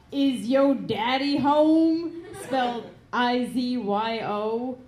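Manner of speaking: spelling out a porn title for laughs; reading the title. Shown as a demonstration. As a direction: savour each word of the title.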